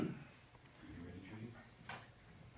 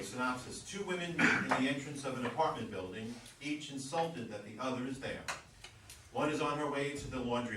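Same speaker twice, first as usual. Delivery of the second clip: usual speaking voice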